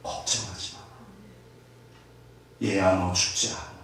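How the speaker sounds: low, very slow, soft and hushed; tender fatherly voice. Acted. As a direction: comfort a frightened child gently. God speaking tenderly to child. (하나님이 아이를 안고 낮고 아주 느리게 속삭이듯 위로함)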